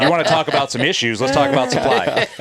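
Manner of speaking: laughing, high and loud. Real, not acted. Mocking the supply guy while laughing. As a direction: laugh through the jab.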